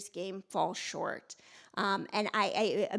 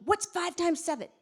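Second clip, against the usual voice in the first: mock urgent quiz; 7 semitones higher than usual. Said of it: "ran into my office and said".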